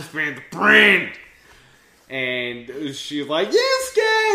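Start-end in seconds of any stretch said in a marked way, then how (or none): 0.0-1.1 loud hype-man yell; much louder, hammed-up battle cry; mock slogan shout. Mock slogan chanted over the recap. 3.5-4.3 high, loud acted yell; hammed-up high-pitched call. Voicing Nana calling out to Yusuke.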